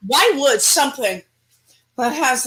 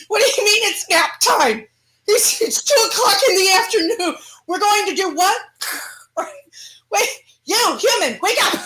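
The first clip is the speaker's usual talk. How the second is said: higher mock-indignant yelp at the idea of a nap; outraged demon; 7 semitones higher than usual. Demon protesting to its sleepy human host, hammed-up outrage.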